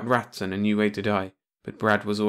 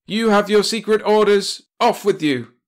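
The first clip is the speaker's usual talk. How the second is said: shouting; 11 semitones higher, 7 dB louder than usual. A narrator's reading. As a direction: yell the demand at your agent. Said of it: "the commander shouted".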